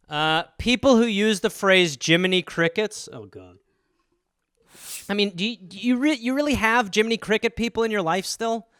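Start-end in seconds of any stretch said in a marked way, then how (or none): none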